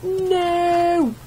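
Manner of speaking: high drawn-out no; despairing no. Real, not acted. Gamer loses again, high despairing no. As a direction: groan it in despair.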